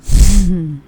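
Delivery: big loud laugh bursting out; loud burst of laughter. Real, not acted. Laughing loudly after telling her son it's not her problem. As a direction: laugh out hard at her own line.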